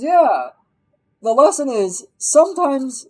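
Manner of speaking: high, loud, insistent explaining; mock earnest. Wife sells the film's lesson to in-law, mock earnest. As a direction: pitch it up and sell the moral hard.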